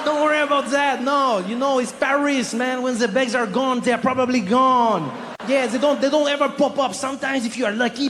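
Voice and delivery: put-on very high, blithely unhelpful French accent; cool French guy. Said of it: "very French, very French, cool guy".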